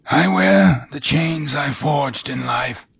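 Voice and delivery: high, wailing otherworldly voice; mournful ghost. Acted. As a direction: lament like a doomed spirit.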